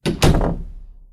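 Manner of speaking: long loud breathy sigh; heavy sigh. Acted. Fosca sighs heavily after Giorgio leaves. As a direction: let out a weary breath.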